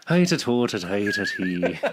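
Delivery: mock Irish accent, lightly done; a half-hearted stage Irish accent; bad Irish accent. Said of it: "my solid Irish one".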